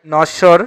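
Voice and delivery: নশ্বর said as "nosh-shor"; doubled consonant. Shown as a demonstration. Teacher contrasts examples, demonstrating doubled sh in নশ্বর. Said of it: "এবার দ্বিত্ব হচ্ছে".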